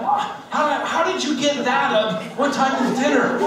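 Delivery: high, loud disbelieving retort; exasperated disbelief. Retold or imitated. Husband answers his wife in the spat, high and baffled.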